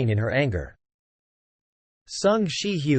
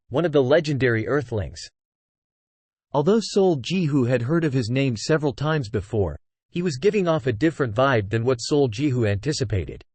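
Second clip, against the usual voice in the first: ordinary delivery, nothing marked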